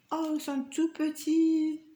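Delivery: high, amazed oh at tiny things; surprised delight. Real, not acted. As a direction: marvel at how small they are. Discovers tiny diamonds unexpectedly.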